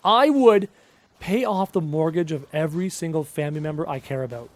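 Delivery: ordinary delivery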